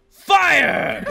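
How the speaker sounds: a high, loud battle order; evil general yelling at his cannons; villain shouting. Acted. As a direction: bellow the command to fire. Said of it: "he shouted".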